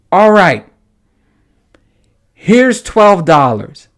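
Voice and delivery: fed-up payment through gritted teeth; mad paying up. Retold or imitated. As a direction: hand over the money furious. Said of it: "So mad".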